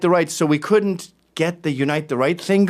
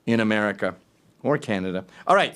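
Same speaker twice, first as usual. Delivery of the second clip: normal talking voice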